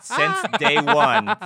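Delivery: high, loud words drawn out through laughter; laughing. Real, not acted. Telling the aunt story, laughing loudly.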